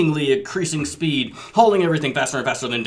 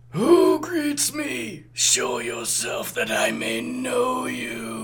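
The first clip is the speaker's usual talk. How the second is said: slow, halting questioning call; wary Megatron voice; 11 semitones higher, much slower than usual. Acted. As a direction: ask slowly and warily. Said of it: "this is a ghostly voice".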